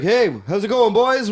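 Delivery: high-pitched friendly call across; yelling over. Retold or imitated. Guys on another dock hailing the fishermen, yelling over. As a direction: call out to them from the next dock. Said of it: "like, yell over".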